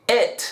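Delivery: it with a vowel halfway between e and i; short i. Shown as a demonstration. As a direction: say it with a lax, short i. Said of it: "combinación muy sutil entre la E y la I".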